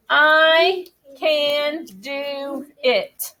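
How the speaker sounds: very slow, stretched words. Shown as a demonstration. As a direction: say it as if pointing at each word. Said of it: "take your pointer power and do it with me".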